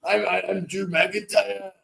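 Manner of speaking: a second stab at the Scottish, giving up; attempted Scottish accent. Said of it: "I can't do the Scottish".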